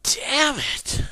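high, loud cursing; exasperated outburst. Pretend. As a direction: burst out loud in frustration. Outburst that opens the rant's anger.

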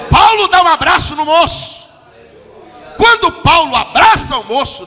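slow, loud, high climactic shout; preacher proclaiming the embrace. Real, not acted. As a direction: hit the climax, draw it out.

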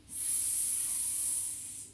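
long soft voiceless hiss; sustained quiet "sss"; snake hiss. Acted. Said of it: "said the snake".

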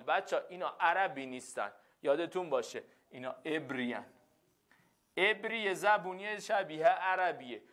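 normal talking voice

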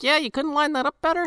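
high mocking sarcasm; sarcastic. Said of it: "And I'm just like".

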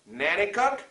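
ordinary delivery

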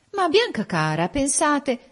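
high-pitched motherly remonstrance; pleading. A narrator's reading. Mother begs her daughter to reconsider, pleading. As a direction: plead with her to think it over.

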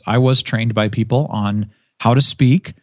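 usual speaking voice

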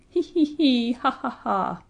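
high, spelt-out cackling he-he, ha-ha; acted jeering laugh, starting high and coming down; mocking laughter. A narrator's reading. Cockatrice laughing at the dragon, mocking.

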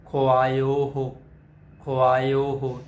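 ਖੁਆਇਓ drawn out slowly, each vowel clear; slow careful pronunciation. Shown as a demonstration. Teacher modelling ਖੁਆਇਓ ਹੋ slowly for the sangat. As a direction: spell it then say ਖੁਆਇਓ ਹੋ slowly. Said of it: "ਖੱਖੇ ਔਂਕੜ, ਐੜੇ ਕੰਨਾ".

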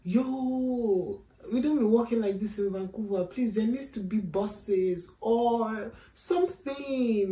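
hammed-up complaining tourist; exasperated complaint. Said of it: "And I'm just like".

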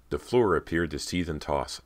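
usual speaking voice

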